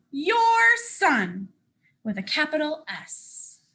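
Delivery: lively storybook read as the boastful sun; reading to children. Acted. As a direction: proudly tell it as the sun to little listeners. Reads the sun's story aloud.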